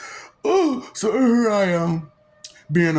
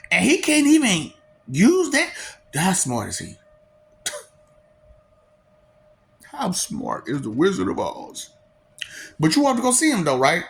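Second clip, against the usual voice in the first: ordinary talk, nothing marked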